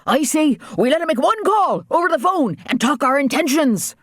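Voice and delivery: high, agitated islander outburst; rally the men in wild anger; wild, angry. A narrator's reading. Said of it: "suggested in a wild, angry voice".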